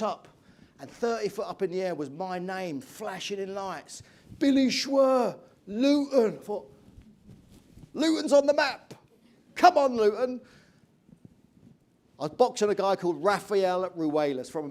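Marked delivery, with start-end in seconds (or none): 4.4-6.3 loud, high, proud announcement; thrilled proclamation. Retold or imitated. Reads his name up in lights. 7.9-10.4 high, loud hometown cheer; rousing cheer. Retold or imitated. Retells his thought as a cheer.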